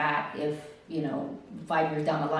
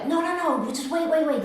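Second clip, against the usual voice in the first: high, hurried stalling; urgent pleading; 9 semitones higher than usual. Retold or imitated. Staff stop patient leaving, urgent. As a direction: act out staff begging someone to stay.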